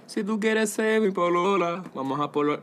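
slow Chilean crooning; mock Chilean accent. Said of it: "un poco chileno".

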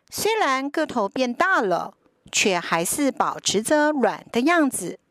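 put-on lively storybook narration for kids; reading to a toddler. A narrator's reading. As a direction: read it like a bedtime picture book. Picture book read to children.

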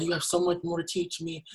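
imitated plea in a high, small voice; pleading child. Quotes dying mother's daughter pleading.

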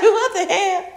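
incredulous. Retold or imitated. Mother hearing her son, startled and incredulous. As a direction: pretend to be floored by it. Her reaction to son's British.

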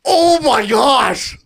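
high, drawn-out cry of disbelief; shocked exclamation. Real, not acted. Podcaster reacts to a controversial take, shocked and drawn-out.

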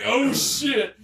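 high-pitched mock alarm; retold alarm. Recounting the collar moment to friend, alarmed. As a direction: re-enact a startled reaction.

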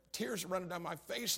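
ordinary talk

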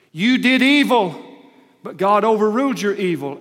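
high, loud, slowed declaration as Joseph; booming preacher voicing Joseph; Joseph's verdict, loud. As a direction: thunder it out as Joseph confronting his brothers. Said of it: "Joseph said".

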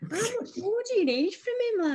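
high, laughing exasperated question; laughing retort. Retold or imitated. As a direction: laugh at mum in disbelief. Teasing mum about sign.

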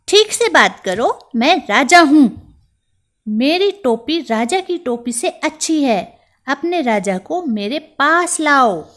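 high, loud, self-important scolding; a tiny mouse playing king; haughty little mouse.

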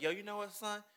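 high-pitched, heartfelt parody; Yugi. Acted. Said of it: "And Yugi was like".

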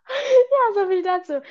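high, laughing through the words; can't stop laughing while talking. Real, not acted. Laughing at the dropped name.

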